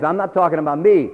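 ordinary talk, nothing marked

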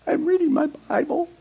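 a comic nervous squeak; sheepish squeak. Retold or imitated. Answering the mocker.

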